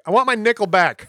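high, loud pun whine; whiny customer voice. Pretend. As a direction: whine for your nickel back. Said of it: "people were like".

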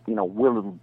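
ordinary delivery

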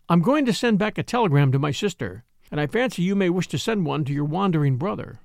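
usual speaking voice

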